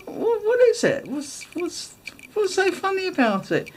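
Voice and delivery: high-pitched puzzled questioning; acted-out bewilderment; baffled innocence. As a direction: squeak it out, totally clueless. Acts out her baffled questioning.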